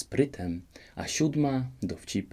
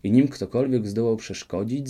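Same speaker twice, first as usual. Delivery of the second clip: usual speaking voice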